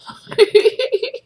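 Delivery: a short high giggle; quick high laugh, rising. Real, not acted. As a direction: giggle at how much it burnt. Laughing after big sip.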